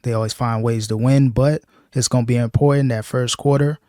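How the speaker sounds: usual speaking voice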